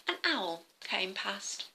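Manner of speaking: ordinary delivery, nothing marked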